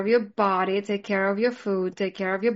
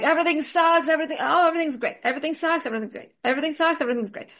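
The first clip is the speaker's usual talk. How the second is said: mood swing; 6 semitones higher, much faster than usual. Retold or imitated. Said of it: "I'm like".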